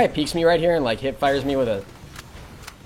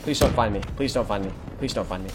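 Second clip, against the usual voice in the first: fading, hushed pleading; whispering while hiding; 5 semitones lower, 5 dB quieter than usual. Real, not acted. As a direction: beg quietly not to be found. Hiding from enemy.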